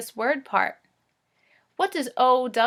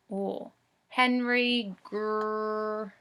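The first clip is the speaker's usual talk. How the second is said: usual speaking voice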